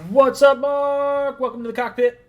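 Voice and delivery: big, high, booming welcome; loud hype greeting. Real, not acted. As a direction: announce it like a show host. Host greets caller on stream.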